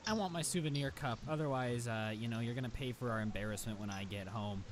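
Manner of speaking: lightly acted stern little boss, pitched low; imitated bossy kid. Kid issues ultimatum to his mom.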